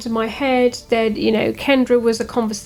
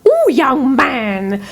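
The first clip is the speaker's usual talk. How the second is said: put-on old gnome lady; 2 semitones higher, 3 dB louder, much slower than usual. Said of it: "she was like".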